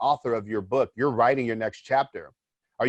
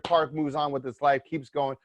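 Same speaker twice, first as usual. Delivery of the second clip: normal talking voice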